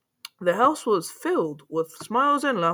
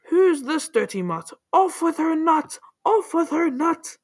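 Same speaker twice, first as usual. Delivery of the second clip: high-pitched outraged command; crying out; 7 semitones higher, 3 dB louder than usual. A narrator's reading. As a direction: cry out the order, pitch climbing. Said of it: "The prince cried".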